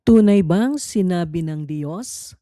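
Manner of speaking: ordinary talk, nothing marked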